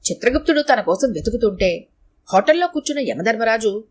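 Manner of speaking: usual speaking voice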